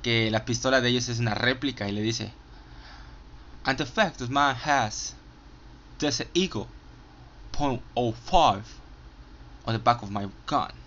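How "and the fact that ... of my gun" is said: put-on English accent quote. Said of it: "con el acento inglés".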